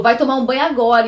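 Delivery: a hint of a harsh father; retold angry order. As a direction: fire the order at her.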